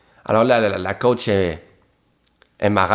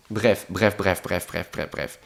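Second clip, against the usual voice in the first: "bref" repeated, fading softer and lower; 5 semitones lower, 7 dB quieter, slower than usual. Real, not acted. Cutting his own rant short, fading to a murmur. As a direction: let it die away to nothing.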